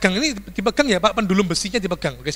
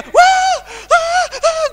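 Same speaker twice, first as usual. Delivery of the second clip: high pained scream; much slower than usual. Retold or imitated. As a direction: scream out high like he's being shocked. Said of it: "Jerit dia". (jeritan tinggi dan keras)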